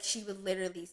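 ordinary talk, nothing marked

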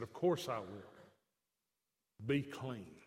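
low, soft assurance; gentle reply. Acted. Jesus gently reassuring the leper, low and soft. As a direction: reassure him quietly, deep and kind.

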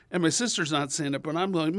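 ordinary talk, nothing marked

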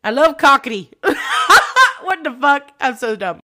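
real helpless laughing fit; cracking up. Real, not acted. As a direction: lose it laughing at your own slip. Cracking up at own slip.

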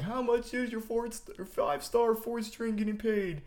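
high-pitched mock yell, no real volume; half-volume heckle in a high voice; retold yell. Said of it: "I, I just yelled".

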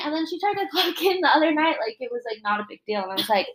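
ordinary talk, nothing marked

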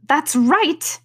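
high put-on queen's shout. A narrator's reading. Queen approving the soldiers' report, shouting. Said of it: "shouted the queen".

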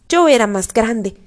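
ordinary delivery

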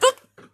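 very high, loud startled scream. Retold or imitated. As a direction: jolt awake screaming. Waking from the fall.

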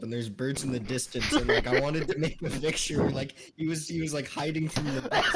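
ordinary talk

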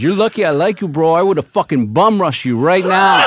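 loud tough-guy threat; threatening. Retold or imitated. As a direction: square up and warn him. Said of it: "And he goes".

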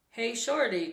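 light narrated call to get attention. A narrator's reading. Slim hailing Shorty across the camp, calling out. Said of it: "called Slim".

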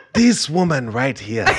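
a light Wakandan king voice. Acted.

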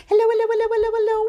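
high, rapid-fire hellos; as fast as possible. Shown as a demonstration. Greeting babies as fast as we can, rapid high voice. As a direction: rattle them off without a gap. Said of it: "as fast as we can".

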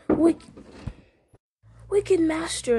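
high, hissing creature voice; hissing Gollum. A narrator's reading. Gollum accusing his wicked master, hissed. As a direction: hiss it as a spiteful accuser. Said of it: "it hissed".

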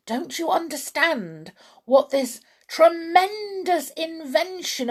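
carried away, big emphatic swells; worked-up excitement. A narrator's reading. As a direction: preach your vision to the boy. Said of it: "working himself up so much".

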